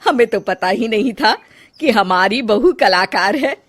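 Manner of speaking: loud, lilting, impressed matriarch; excited, admiring mother-in-law voice; delighted mother-in-law. Acted. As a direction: exclaim proudly over the paintings.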